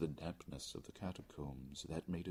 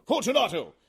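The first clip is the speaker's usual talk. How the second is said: high, loud call; calling aloud; 15 dB louder than usual. Acted. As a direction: call into the niche. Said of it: "I called aloud".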